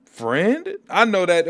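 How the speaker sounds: touched disbelief. Retold or imitated. Salaka hearing Battle Beast call him friend, moved surprised rise. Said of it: "He said".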